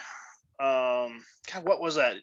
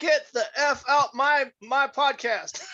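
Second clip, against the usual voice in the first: high mock drill-sergeant dismissal; drill instructor; 10 semitones higher than usual. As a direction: play a sergeant throwing someone out. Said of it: "that act the drill instructor mode".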